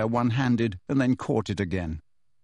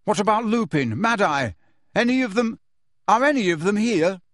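higher, anxious questioning; urgent questions; 7 semitones higher, 3 dB louder than usual. Acted. Harry desperately asking Kreacher about friends, anxious. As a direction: fire off worried questions.

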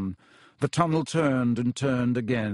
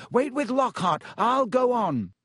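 high, urgent, raised voice; tense, high-pitched calling; 10 semitones higher than usual. A narrator's reading. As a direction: call instructions across a barrier, leaving the last one hanging. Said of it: "he called to Ron".

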